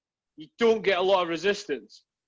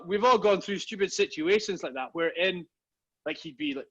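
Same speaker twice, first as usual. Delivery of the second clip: ordinary talk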